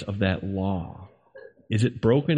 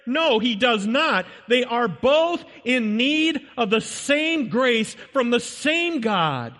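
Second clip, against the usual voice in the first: high, slow preacher's cry; fervent sermon climax; preaching at full force; 13 semitones higher than usual. Acted. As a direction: ring it out from the pulpit.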